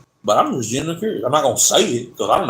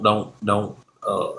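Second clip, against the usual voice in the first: normal talking voice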